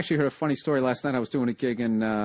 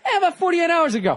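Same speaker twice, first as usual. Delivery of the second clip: higher, laughing mockery of a friend's claimed calm; mock calm recovered friend; 13 semitones higher, 3 dB louder than usual. Host teasing his friend, laughing mock voice of the calmer man. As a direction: laugh while parodying his breezy denial. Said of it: "You're doing this whole thing".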